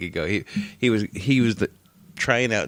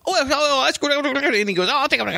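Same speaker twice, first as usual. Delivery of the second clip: fast-talking drive-through guy. Retold or imitated. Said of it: "really fast".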